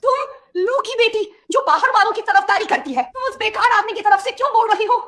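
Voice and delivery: high-pitched angry scolding. Acted. Mother berating daughter for siding with outsiders, angry. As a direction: scold her angrily, pitched right up. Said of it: "क्यों गुस्सा हो रही हो".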